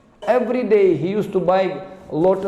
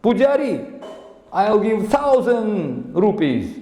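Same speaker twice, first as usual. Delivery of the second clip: high, slow, scornful outbid; challenging businessman; 5 semitones higher, slower than usual. Acted. Rich businessman outbids the pujari, haughty challenge. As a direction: throw down the challenge, pitched high and drawn out. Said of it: "this businessman ac-, accepted challenge".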